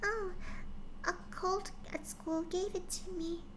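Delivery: put-on high, soft young filly voice; little filly answering her father, high and soft; quietly. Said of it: "she said quietly".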